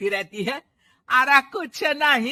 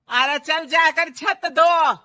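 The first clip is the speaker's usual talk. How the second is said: loud, angry command; angry order; 5 semitones higher, 5 dB louder than usual. Acted. Mother-in-law angrily orders daughter-in-law to wash roof, loud. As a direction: order her off to scrub the roof.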